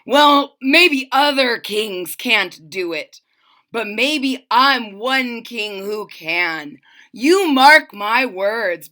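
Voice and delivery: loud, slow defiant vow; slow, seething royal promise; raging king. Acted. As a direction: hammer every word as a threat. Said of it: "flew into a terrible rage".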